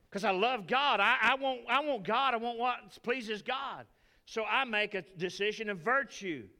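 full-force preaching. Acted. Pastor fires up the congregation about loving God, preaching hard. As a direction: lift it up and preach it out.

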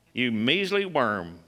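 hammed-up condemning voice; a harsh accuser tearing the sinner down; mock scolding God. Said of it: "that wasn't God".

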